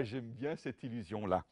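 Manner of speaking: usual speaking voice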